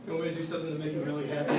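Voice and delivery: low, soft and hurried, conspiratorial; father's quiet offer. Retold or imitated. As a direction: drop it low and quick, as a private offer to your son. Father voices offer to son.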